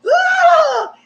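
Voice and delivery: high shouted wail; mock wail. Huda sobbing in the villa, high mock wail. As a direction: cry out in mock despair.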